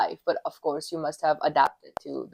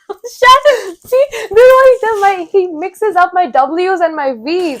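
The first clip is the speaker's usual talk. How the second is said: much higher, loud laughing outburst; indignant protest; 12 semitones higher, 10 dB louder, slower than usual. Real, not acted. Teased about mixed-up W's and V's, shrill protest. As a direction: shriek it in amused outrage.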